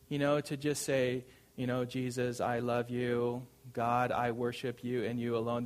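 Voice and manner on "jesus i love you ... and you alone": monotone, evenly paced robotic devotion; programmed robot. Acted. Preacher mimics programmed people professing love to God, robotic monotone. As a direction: recite it by rote, all on one note. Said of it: "that we are programmed".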